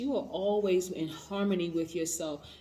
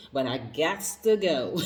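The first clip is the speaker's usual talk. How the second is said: laughing sass; 5 dB louder, slower than usual. Retold or imitated. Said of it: "Hey, you know".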